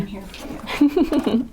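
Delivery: mock-sincere. Playful reply to thanks.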